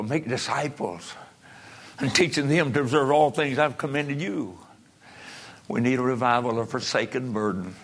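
ordinary talk, nothing marked

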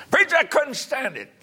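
high-pitched cry as the desperate mother; anguished outcry. Retold or imitated. Mother tells the preacher of her lost boy, crying out. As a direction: burst out as a mother who can't bear it.

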